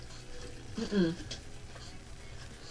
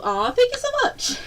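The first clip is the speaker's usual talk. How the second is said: very high, touched squeal; gushing thanks. Real, not acted. Streamer thanking a viewer, high gushing squeal. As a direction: squeal your thanks at a sudden compliment.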